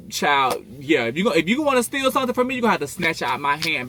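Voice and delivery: high, loud sassy warning to thieves. Real, not acted. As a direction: warn them off with attitude, loud.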